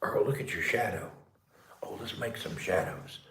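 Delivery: low hushed whisper. Real, not acted. Showing his pet its shadow, low whisper. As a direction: whisper it to him like a secret.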